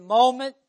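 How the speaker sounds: "moment" repeated alone, loud and emphatic; stress on moment. Real, not acted. Preacher repeating a key word, "moment" loudly emphasized. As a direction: hit "moment" hard by itself. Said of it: "I emphasize the word again".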